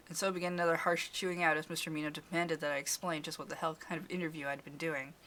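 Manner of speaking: plain reading voice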